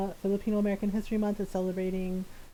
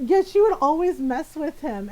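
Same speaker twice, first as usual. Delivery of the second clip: normal talking voice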